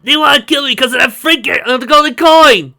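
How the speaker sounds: very high and loud throughout; high loud frantic rant; panicked pleading yell. Acted. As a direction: blurt it out in terror. Half-orc continues panicking.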